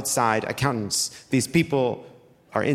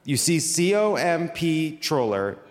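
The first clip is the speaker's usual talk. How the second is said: ordinary talk, nothing marked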